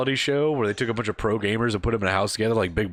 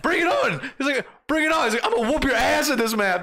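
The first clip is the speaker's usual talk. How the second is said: much higher taunting challenge; hyped challenge; 13 semitones higher than usual. Retold or imitated. Gamers trading challenges, hyped mock taunt. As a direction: dare them to come at you.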